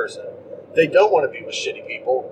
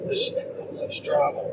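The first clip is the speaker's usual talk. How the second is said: whispered, much softer aside; 7 dB quieter than usual. Real, not acted. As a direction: let it slip as a secret. Aside about girl.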